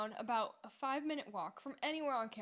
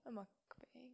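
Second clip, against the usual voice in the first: whispered, unvoiced mukbang; 8 dB quieter, slower than usual. Real, not acted. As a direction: breathe the word quietly. Whispered word in unvoiced line.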